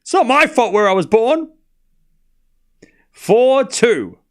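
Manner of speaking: loud, high, hammed-up tearful whine; mock tears. Commentator blaming birthplace for the accent, mock-tearful. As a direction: keep up the fake crying, even through the score. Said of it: "we'll save those tears".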